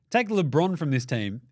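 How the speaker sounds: ordinary talk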